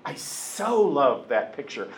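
slow, with "so" drawn out; stress on so. Retold or imitated. Imitating someone gushing over a picture, "so" drawn out. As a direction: gush it with a long "so". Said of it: "when someone says to you".

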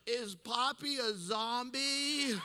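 put-on high, slow little-kid question; five-year-old child. Five-year-old asking about dead grandpa, high childlike voice. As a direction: imitate a small child, stretched high voice. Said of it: "The little five-year-old is going".

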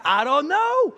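high, loud mock cluelessness; clueless protest. Husband answering his wife back home, mock-clueless.

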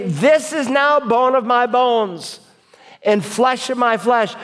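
high, excited declaration; Adam. Acted. Adam delighted at his new wife, exclaiming high and excited. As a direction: proclaim it in delight, pitched well up. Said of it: "Adam exclaimed".

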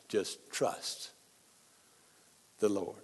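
near-whispered solemn trust; hushed. Real, not acted. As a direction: breathe it out softly. Sermon close of thought.

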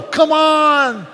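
loud, high drawn-out "Come on"; shouted urging. Retold or imitated. Preacher shouting at his driver to speed up. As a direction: hurl the "Come on" as a big shout. Retold yelling at slow driver.

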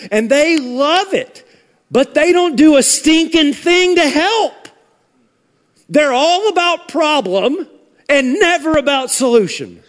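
high, indignant pulpit delivery; forceful preaching. Real, not acted. Preacher rebuking those who confront but never help, forceful preaching.

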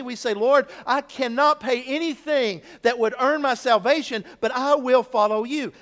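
loud, driving sermon voice; full-force preaching. Acted. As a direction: preach it out, building.

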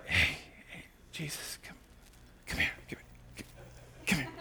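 hushed, urgent beckoning; acted secretive whisper; furtive whisper. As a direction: whisper as if quietly pulling someone aside. Peter discreetly pulls Jesus aside.

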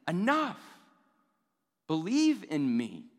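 a fed-up father demanding courage; frustrated God. Retold or imitated. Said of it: "You can sense that frustration".

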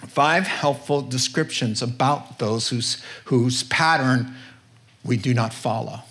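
usual speaking voice

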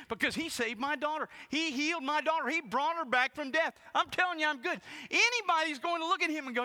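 high, loud, full-force preaching; impassioned shouting. Acted. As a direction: act out the heated argument at the top of the voice. Preacher acts heated imagined exchange.

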